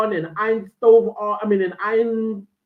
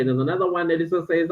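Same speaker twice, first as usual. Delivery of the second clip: ordinary talk, nothing marked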